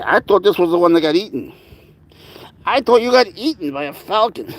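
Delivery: high-pitched, delighted fussing; excited relief. Real, not acted. To a baby animal thought eaten, excited relief. Cooing to animal found safe.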